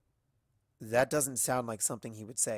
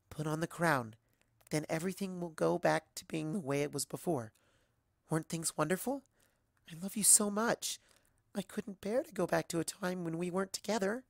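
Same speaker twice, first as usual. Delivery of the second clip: Stargazer's sweet plea; 6 semitones higher than usual. Acted. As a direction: beg her tenderly to stay in the dream. Stargazer pleading with Twilight.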